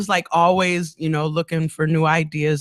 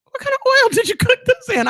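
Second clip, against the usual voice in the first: suspicious question; faster than usual. Retold or imitated. Suspicious questioning of friend's cooking.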